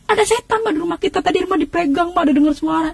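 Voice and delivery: retold alarm. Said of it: "saya teriak". (menirukan aduan panik ke ibu)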